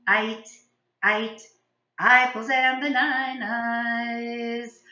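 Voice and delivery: "I like to eat" sung with every vowel turned to long I; slow silly sung verse swapping every vowel for I; all vowels as I. Shown as a demonstration. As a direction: sing "bananas" as "bynynies" and "apples" as "ipples". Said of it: "now an I sound".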